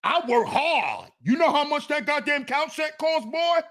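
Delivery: mock grown men griping like their mamas; hammed-up motherly scolding.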